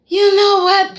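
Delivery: mock breezy brush-off. Mocking Bill as he bails when things get difficult, mock breezy. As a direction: toss it out high and loud. Acts out Bill running away.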